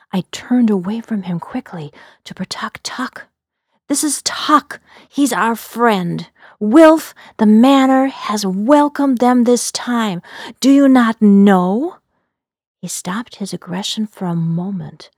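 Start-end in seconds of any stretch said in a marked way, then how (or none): 3.9-12.0 earnest defence. Acted. Pleading to defend Tuck.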